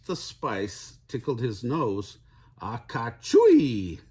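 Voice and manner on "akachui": big, loud, drawn-out fake sneeze; acted sneeze. A narrator's reading. Kitty boy with spice in his nose, sneezing. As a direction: sneeze it out in slow, exploding syllables. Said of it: "The kitty boy sneezed".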